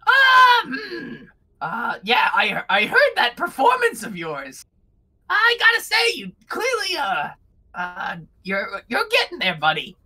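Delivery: high, chirpy little kobold with a backhanded compliment; squeaky kobold Bug. Acted. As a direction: jump the pitch way up, cheeky and friendly. GM keeps voicing Bug.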